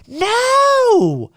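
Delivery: a sudden thrilled yelp; excited shout. Real, not acted. Fan thrilled by the movie lineup, excited yelp.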